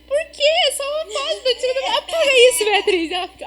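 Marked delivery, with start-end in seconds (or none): 0.1-2.0 high mock-innocent protest, laughing; a teen's laughing whine; retold protest. 2.0-3.1 laughing, louder mock scold; half-laughing order to delete it. Mother retold scolding.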